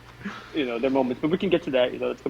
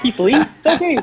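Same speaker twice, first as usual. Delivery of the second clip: high, louder sarcastic "it's okay"; mock praise, pitched up and loud; sarcastic dismissal; 10 semitones higher, 7 dB louder than usual. Real, not acted. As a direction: scoff at him mockingly. Mocking Keith Lee's worth.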